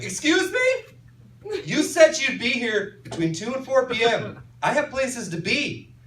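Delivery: high, indignant demand. Retold or imitated. Angry caller to cops: high indignant complaint. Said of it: "I'm losing my shit".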